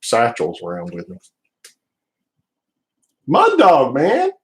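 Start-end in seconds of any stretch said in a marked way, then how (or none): 3.3-4.3 high, loud, drawn-out hail; excited greeting. Real, not acted. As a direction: call out a buddy's name, pitched way up. Hailing a friend joining the chat.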